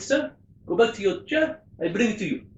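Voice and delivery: put-on an amateur's French accent for the barman. Barman apologising to a skier, mock French accent. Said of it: "excuse my accents".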